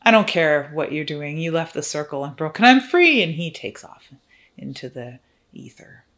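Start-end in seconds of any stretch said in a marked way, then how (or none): 0.0-3.2 a lightly voiced gloating demon, rising loud at the end; mocking demon. Freed demon gloating at the mortals who broke the circle. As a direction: sneer at the foolish mortals, then crow your freedom. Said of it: "laughs his little tailed ass off and says".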